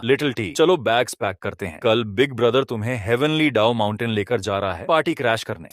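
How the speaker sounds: ordinary delivery, nothing marked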